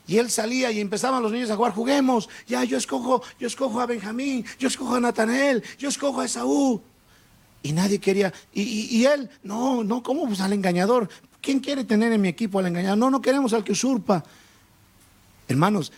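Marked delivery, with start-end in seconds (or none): 1.7-6.8 high-pitched playground calls; mock child at play; children picking teams. 9.4-14.2 put-on children refusing teammate. Acts children rejecting the deceiver.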